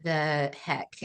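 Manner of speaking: slow, low, hushed what the heck; stunned disbelief. Retold or imitated. Alone with a positive test, slow stunned murmur. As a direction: drag it out in quiet disbelief.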